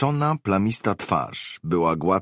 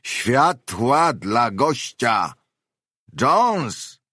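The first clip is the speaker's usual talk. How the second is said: loud, drawn-out high holler; hoarse shout; 6 semitones higher, 5 dB louder, much slower than usual. A narrator's reading. Unseen man orders Jones to light up for a guest, hoarse shout. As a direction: bellow the order slowly, pitched up. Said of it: "wykrzyknął ktoś chrapliwie".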